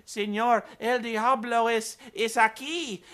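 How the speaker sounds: mock Spanish. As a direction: play the frantic housekeeper in mock Spanish. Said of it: "she's like".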